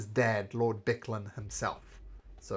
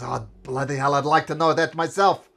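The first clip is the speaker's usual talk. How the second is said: exasperated Cudgel; 3 semitones higher, 9 dB louder than usual. Acted. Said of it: "And Cudgel says".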